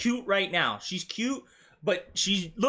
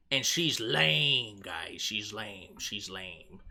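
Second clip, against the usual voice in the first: mock low drone; 12 semitones lower, 5 dB quieter, slower than usual. Mocking the actress, voice sinks.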